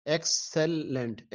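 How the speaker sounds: slow three-beat count; split into syllables. Shown as a demonstration. Teacher counting syllables aloud, slowly. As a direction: say excellent slowly as ek-se-lent, three beats. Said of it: "तो इसके तीन हैं".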